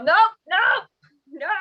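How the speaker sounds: loud, panicked repeated no; mock frightened yell at the knock. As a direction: shout them away from the door. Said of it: "And then you just yell".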